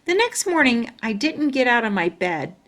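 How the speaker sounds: usual speaking voice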